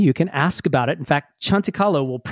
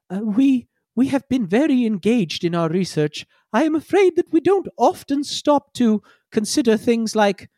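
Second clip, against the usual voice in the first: higher, far-off polite robot; apologetic bot; 6 semitones higher than usual. Acted. Chanti Kalo leans in and apologises to the crew, higher robot voice. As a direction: sheepishly admit an oversight.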